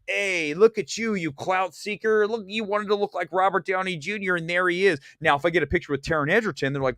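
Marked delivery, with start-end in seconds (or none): 0.1-5.0 mocking accusers. AMC people accusing him of clout seeking, mocking taunt. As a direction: imitate hecklers calling out a phony. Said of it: "the A M C people would come in and say".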